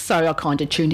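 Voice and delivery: ordinary delivery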